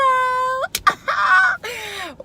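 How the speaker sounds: long, piercing high held cry; high loud whoop. Real, not acted. Vlogger wrapping up with a subscribe plea, high loud whoop. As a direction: let out a drawn-out high shriek, very loud.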